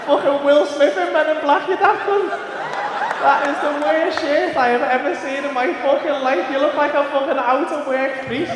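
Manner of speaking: high, loud, laughing teasing; loud laughing roast. Real, not acted. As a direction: shout the roast through laughter. Roasting friend's shirt, laughing.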